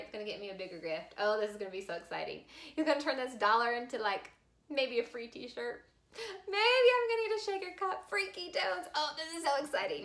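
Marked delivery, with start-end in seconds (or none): 2.8-10.0 high, fast giddy whine; childish excitement. Retold or imitated. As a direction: squeal over the prize. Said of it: "I'm thinking".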